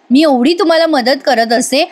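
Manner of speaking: ordinary talk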